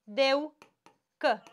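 syllable by syllable. Shown as a demonstration. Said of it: "Boș".

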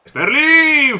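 sudden high call of the name; excited call. Real, not acted. Live commentary shout. (llamada emocionada)

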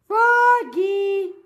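high, loud call with a long-held name. Acted. Mother calling Froggy from elsewhere, loud call. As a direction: call loudly for your child. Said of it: "called his mother".

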